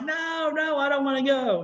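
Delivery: high-pitched retold child's protest; frightened refusal. Girl resisting grandmother's spirit, high mock-frightened wail. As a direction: imitate a scared girl pleading to stay.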